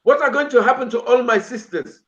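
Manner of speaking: mock aunt fretting about unmarried sisters; imitating an African auntie. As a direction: play the worried auntie, a touch hammed-up. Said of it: "They're like".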